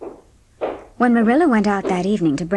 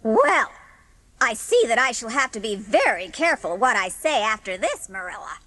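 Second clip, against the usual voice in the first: huffy, offended neighbour; lightly voiced miffed old lady; Mrs. Lynde; 3 dB quieter than usual. Mrs. Lynde leaving Marilla's house in a huff, lightly voiced.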